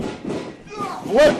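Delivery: high, drawn-out flip call; excited call. Real, not acted. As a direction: stretch it out in wonder. Live call of big move.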